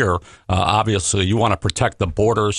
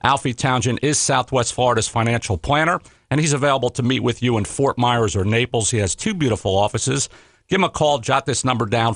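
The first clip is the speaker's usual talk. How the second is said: ordinary talk, nothing marked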